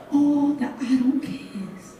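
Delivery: poem delivered with building intensity; spoken word poem. Acted. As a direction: perform it like a slam poem to a crowd.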